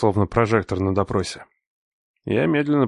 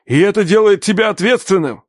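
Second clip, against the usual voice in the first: shout; 11 semitones higher, 6 dB louder than usual. Acted. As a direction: hammer it in as accusation. Father yells accusation.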